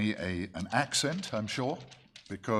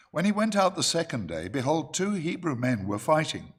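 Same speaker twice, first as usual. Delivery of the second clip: higher, louder Bible-reading voice; scripture reading; 5 semitones higher, 5 dB louder than usual. Acted. Preacher reads Exodus to the church, emphatic scripture reading. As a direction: proclaim the verse out to the congregation.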